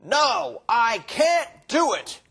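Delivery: loud, high-pitched harsh no; irritated shout of refusal; angry refusal. Shown as a demonstration. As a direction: refuse sharply, nearly shouting. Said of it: "But instead, he said".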